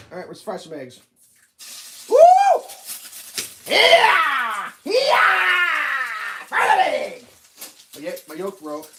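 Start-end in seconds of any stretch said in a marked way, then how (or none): 2.1-7.2 high, loud whoop; high cheering shout over the pan; excited cheer. Real, not acted. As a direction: hype yourself up at the stove. Cheering while cooking.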